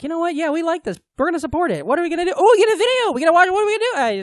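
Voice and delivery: high-pitched mock fan voice; high, eager put-on voice; imitating eager fans. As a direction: play excited supporters, pitched well up. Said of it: "people on our Patreon who are like".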